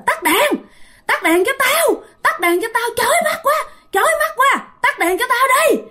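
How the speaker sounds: high shrieking demand to turn off the lights; possessed girl screaming. Retold or imitated. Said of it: "nó la làng". (giọng cô gái bị nhập la hét chói tai)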